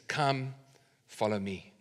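very soft, low invitation; hushed call. Acted. Jesus' rally cry to each of us, spoken hushed and low.

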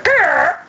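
short, high imitated noise; imitated screech. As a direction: break in with an abrupt sound effect. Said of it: "all of a sudden you hear".

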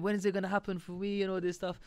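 ordinary talk

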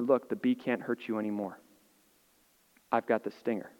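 calm reassurance. Retold or imitated. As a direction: soothe a scared child. Dad calming son after bee.

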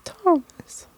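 high whiny call of the name; shrill nagging call, mildly done; whiny wife call. Retold or imitated. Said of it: "I'd scream at her".

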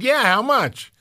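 jumps up high with a quick eager yes; mock eager grab at the money. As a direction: snap up the offer. Eager reply to a job offer.

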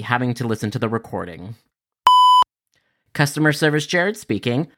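ordinary talk, nothing marked

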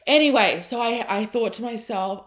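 ordinary talk

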